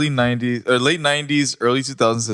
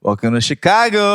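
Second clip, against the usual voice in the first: high, mock tour-guide welcome; sarcastic welcome; 8 semitones higher, 5 dB louder than usual. Friend teasing about Chicago traffic, sarcastic announcer. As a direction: announce it like a cheery sign. Friend mocks snowy rush hour.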